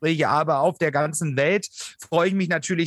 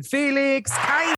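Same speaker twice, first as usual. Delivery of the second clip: ring announcer; 7 semitones higher than usual. Acted. Introducing guest like ring announcer.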